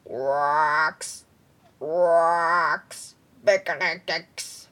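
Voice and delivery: low, loud croaking gibberish; toad voice. Acted. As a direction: croak like a dumb young toad.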